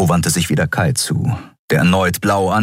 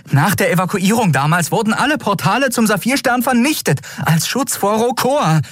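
high, agitated protest; exclaiming in disbelief. A narrator's reading. Endriel disputes that portals survive, high exclamation. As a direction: argue it away urgently. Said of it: "rief sie aus".

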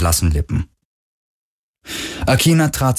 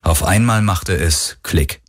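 plain reading voice

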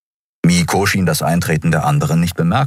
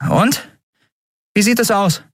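higher voice for the captain Endriel, curious; Endriel, raised voice. Acted. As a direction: lift the pitch, eager question. Endriel asks about the ship. (höhere Stimme, neugierig fragend)

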